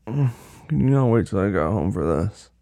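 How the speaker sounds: ordinary talk, nothing marked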